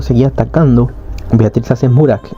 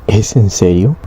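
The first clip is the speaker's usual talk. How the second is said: usual speaking voice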